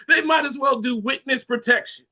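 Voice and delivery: ordinary talk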